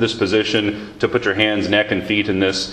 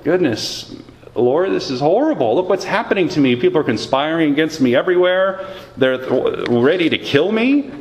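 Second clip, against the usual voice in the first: distressed; 6 semitones higher than usual. Retold or imitated. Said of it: "So he's saying".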